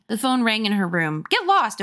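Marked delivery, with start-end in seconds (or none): 1.3-1.8 high, sharp brush-off; exasperated, pitched-up big sister; irritated dismissal. Acted. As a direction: shoo a pesky brother away. Sister snapping at brother.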